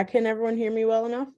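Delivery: ordinary delivery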